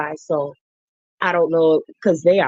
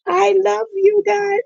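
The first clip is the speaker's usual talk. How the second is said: gushing love; 13 semitones higher than usual. Real, not acted. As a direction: stretch it out like you adore them. Gushing to viewers joining.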